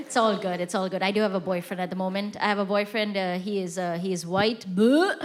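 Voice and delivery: ordinary delivery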